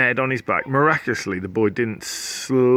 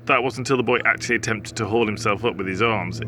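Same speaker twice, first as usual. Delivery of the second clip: plain reading voice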